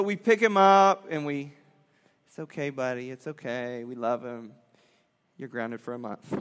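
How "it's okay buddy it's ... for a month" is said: lower, soft soothing words; tender comfort. Retold or imitated. Parents to sobbing son, soft and low. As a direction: hush him gently.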